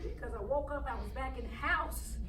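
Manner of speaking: very soft, low, dazed recollection; subdued. Acted. Girl telling Miss Wade about waking at home, subdued. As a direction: speak low, as if just coming round. Recalls waking after fainting.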